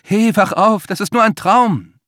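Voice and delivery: high and loud urgent waking call; Liz's voice. A narrator's reading. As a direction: shake him awake. Liz wakes Max from nightmare.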